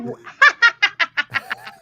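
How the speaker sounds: long high witchy cackle; witch cackle. Pretend. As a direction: cackle wide-mouthed like a witch. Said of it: "I open my mouth, like, so big".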